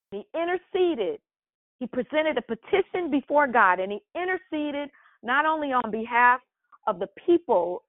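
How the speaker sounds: high-pitched, impassioned preaching with a hammered refrain; preaching full force. Real, not acted. Pastor urges leaders in the church to stop worrying, preaching hard and high. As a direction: build the fire, pounding listen to me. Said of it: "I'm getting a little excited".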